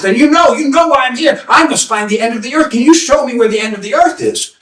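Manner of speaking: put-on high-pitched, urgent young seeker; eager pleading. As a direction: press the seer for an answer.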